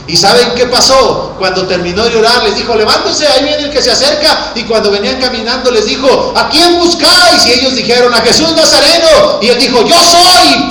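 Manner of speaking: high, shouted preaching build; prédica a gritos. Acted. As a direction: drive the story up to a shouted climax. Relato predicado a gritos. (relato predicado a voz en cuello)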